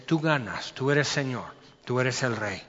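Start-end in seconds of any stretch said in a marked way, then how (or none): none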